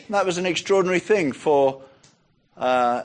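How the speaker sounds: normal talking voice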